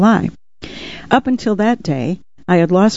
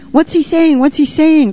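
put-on much higher, eager child voices; children crying out; 11 semitones higher than usual. A narrator's reading. Children pressing to hear what the dove said, crying out eagerly. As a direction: pitch it right up like clamouring kids. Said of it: "cried the children almost in unison".

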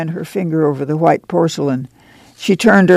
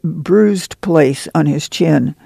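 usual speaking voice

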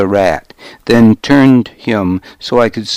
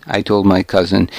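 usual speaking voice

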